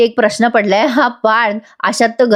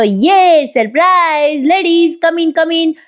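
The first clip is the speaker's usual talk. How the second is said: high, drawn-out party cheer; mock excited welcome; 7 semitones higher, much slower than usual. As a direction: play the host delightedly ushering guests in. Acted excited welcome of surprise guests.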